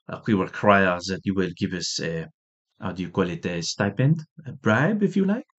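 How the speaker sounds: lightly put-on French accent, sneering; a hint of a snooty Frenchman demanding a bribe; mock French diplomat. French negotiators brushing off the Americans, in a light mock French accent. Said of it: "They say".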